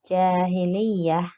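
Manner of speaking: "jahiliyah" slow, with the first a held long; "jahiliyah" spelled out slowly, long a; long vowel demo. Shown as a demonstration. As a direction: stretch the a after the h in "jahiliyah". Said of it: "dibaca panjang".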